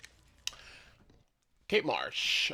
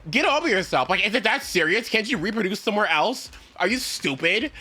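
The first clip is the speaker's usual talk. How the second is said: exasperated rant; 6 semitones higher than usual. Real, not acted. Ranting at the mold.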